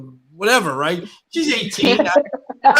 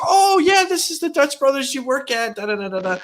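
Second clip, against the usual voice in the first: imitating his daughter; 8 semitones higher than usual. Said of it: "and she says".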